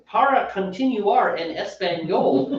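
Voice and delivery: imitated phone recording in slow, terrible gringo Spanish.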